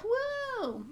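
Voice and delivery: high, hooting woo; excited cheer. Real, not acted. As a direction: whoop like a fan. Cheering a pro tip.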